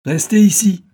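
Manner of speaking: loud, high commanding shout; acted thunderous command; thundering order. A narrator's reading. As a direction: order him to stay in a booming voice. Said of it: "ordonna Joey d'une voix tonnante".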